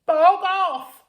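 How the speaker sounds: very high, loud scream of "Bog off!"; shouted. Acted. Girl screaming at the rubbish monster, very high and loud. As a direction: shriek it at the monster. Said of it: "shouted Gertrude".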